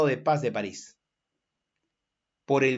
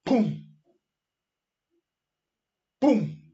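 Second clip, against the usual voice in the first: higher-pitched spoken "pum" for cannon fire; cannon boom; 7 semitones higher than usual. Shown as a demonstration. Teacher acts out cannon firing for class, imitated boom. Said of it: "¿qué hacía el cañón?".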